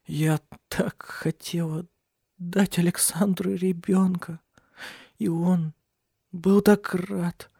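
high, halting, slow weeping voice; sobbing. A narrator's reading. Cecilia grieving the child she lost, sobbing. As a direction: choke it out in broken, tearful pieces. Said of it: "Она зарыдала, трепеща всем телом".

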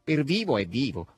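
grudging, drawn admission; reluctant. A narrator's reading. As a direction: concede it unwillingly. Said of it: "rispose a malincuore Asasello".

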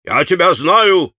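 sharp and annoyed, a bit slow; angry clerk exclaiming; irritated exclamation. A narrator's reading. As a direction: jab the words at him. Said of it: "восклицал он раздражённо".

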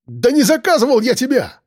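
high, desperate cry of denial. A narrator's reading. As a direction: exclaim in panic, pitched far up. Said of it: "выкрикнул он".

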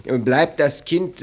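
ordinary delivery

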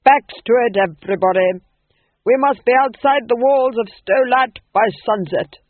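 loud, commanding call; rallying order. A narrator's reading. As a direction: rouse the troupe onto the road. Actor-manager orders troupe.

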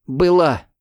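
loud, near tears; tearful shout. A narrator's reading. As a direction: shout it almost crying. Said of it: "крикнула Алина, чуть не плача".

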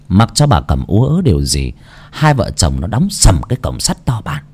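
usual speaking voice

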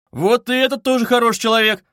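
loud, high, lively praise; animated. A narrator's reading. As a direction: burst out with sudden enthusiasm. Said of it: "необыкновенным до сих пор оживлением".

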